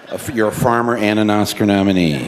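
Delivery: mock the claim like a disbelieving guard; scoffing officer. Airport officer scoffing at the farmer's Oscar claim, loud and incredulous.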